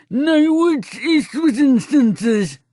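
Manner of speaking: high, slow, lips-still ventriloquist voice. Acted. Narrator does ventriloquism at shoppers, high and slow. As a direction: throw the words like a ventriloquist dummy. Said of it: "in my best ventriloquist voice".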